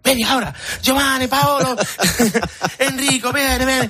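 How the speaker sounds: frantic Italian tailor. Retold or imitated. Imitating the Roman tailor calling his staff, frantic Italian manner. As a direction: summon the whole workshop in a panic. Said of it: "Como son los italianos".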